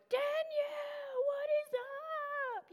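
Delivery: high, drawn-out party shout of her name; excited greeting. Retold or imitated. Drunk girl outside bar greets old party friend, high excited call. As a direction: call out tipsy and thrilled.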